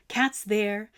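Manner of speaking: usual speaking voice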